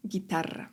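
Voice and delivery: "Gitarra" with a clearly trilled double R; strong double R. Shown as a demonstration. Teacher explaining erre doble, "Gitarra" with strongly rolled RR. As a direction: roll the RR in "Gitarra" very distinctly. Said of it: "jest wymawiane bardzo wyraźnie".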